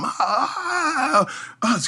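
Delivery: high, sharp pained "ah" cries; imitated cry of pain. Injured athlete crying out on the ramp, high pained yelps. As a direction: yelp like a man whose knee just gave out. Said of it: "you hear him".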